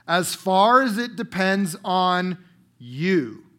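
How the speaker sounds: slow build, then "you" set apart after a pause; "you" isolated and dropped in pitch for emphasis; stress on you. Shown as a demonstration. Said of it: "let me emphasize the word you".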